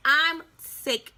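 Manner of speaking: ordinary delivery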